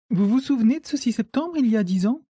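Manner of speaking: high, lifted questioning voice; young woman's voice. A narrator's reading. Manon questions the old man about that September, high woman's voice.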